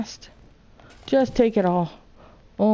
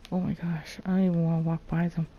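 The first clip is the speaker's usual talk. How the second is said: normal talking voice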